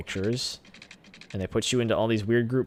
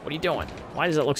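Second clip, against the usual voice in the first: voiced disbelief; 6 semitones higher, faster than usual. Real, not acted. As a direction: ask the game outright, pitch up. Scolding game twice, whisper then voice.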